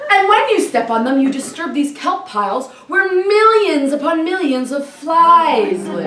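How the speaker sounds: ordinary talk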